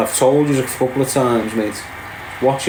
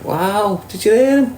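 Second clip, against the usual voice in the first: surprised; 7 semitones higher than usual. Real, not acted. Streamer reacts to fast talker.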